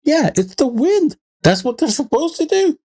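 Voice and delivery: very high, incredulous retort; mock exasperated dad. Dad dismisses daughter's fear of moving ghosts, mock exasperation. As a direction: squeak out a mock-exasperated explanation.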